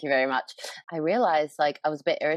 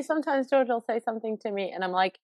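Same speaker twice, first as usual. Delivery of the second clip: ordinary delivery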